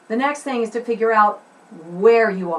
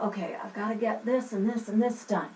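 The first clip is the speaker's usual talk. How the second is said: hushed note-to-self; much softer, private to-do list; talking to oneself; 2 semitones lower, 11 dB quieter than usual. Shown as a demonstration. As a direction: think aloud under your breath. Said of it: "talking just to yourself".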